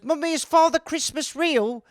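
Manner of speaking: put-on high, innocent little kid voice; four-year-old child. Little boy asks mother about Santa, childlike. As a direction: ask like a small child. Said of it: "four years old".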